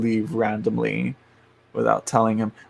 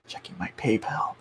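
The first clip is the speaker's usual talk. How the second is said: soft unvoiced whisper; whispered aside; 8 dB quieter than usual. Real, not acted. Checking PayPal, whispering to herself. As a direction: whisper it to yourself.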